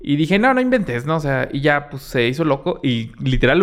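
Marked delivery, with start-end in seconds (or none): 0.4-1.0 much higher "no, no inventes"; fed up and incredulous; exasperated disbelief. Retold or imitated. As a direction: third stolen package, fed up. Fed up with the third theft.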